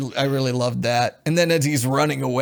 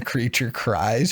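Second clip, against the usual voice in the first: ordinary delivery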